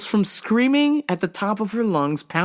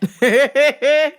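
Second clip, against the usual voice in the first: loud, high laugh; 8 semitones higher, 6 dB louder than usual. Real, not acted. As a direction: crack up. Laughing at own story.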